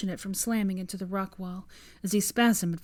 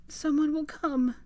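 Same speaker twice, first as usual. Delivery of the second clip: quivering; 8 semitones higher than usual. Acted. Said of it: "her lips quivering".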